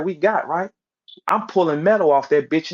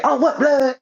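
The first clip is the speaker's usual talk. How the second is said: high-pitched mock outburst; mock startled reaction; 8 semitones higher than usual. Retelling Hollywood's reaction to the story, mock startled.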